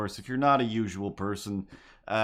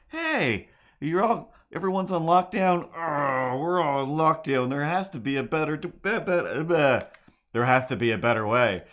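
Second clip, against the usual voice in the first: whiny cat voice; 5 semitones higher, 4 dB louder than usual. Acted. Cats voiced complaining about lockdown.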